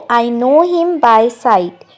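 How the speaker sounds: very slow, careful English model line; slow model sentence. Shown as a demonstration. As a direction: say each word slowly for learners. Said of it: "English में कहेंगे". (धीरे-धीरे साफ़ बोला गया वाक्य)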